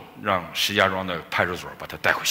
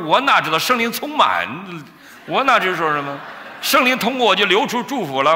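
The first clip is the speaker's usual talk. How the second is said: high-pitched, louder retold protest; flustered protest; 11 semitones higher, 4 dB louder than usual. Preacher acting his reply to the worried pastor, flustered protest. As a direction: protest your innocence in a raised pitch.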